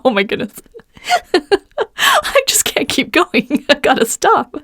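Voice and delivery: high, giggly panic, laughing mid-sentence; laughing through it. Retold or imitated. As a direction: laugh your way through the words. Retelling her botched haircut panic.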